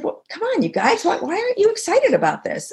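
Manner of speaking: high-pitched, pleading pep talk to a team; rally your silent colleagues, pitched well up; excited urging. Retold or imitated. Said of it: "I got excited about something".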